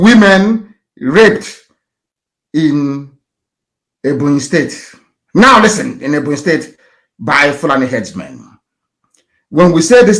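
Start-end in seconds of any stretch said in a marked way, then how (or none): 0.0-4.9 each word drawn out slowly for typing; deliberate dictation pace. Real, not acted. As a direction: spell it out slowly so they can type it. Dictating search terms to type.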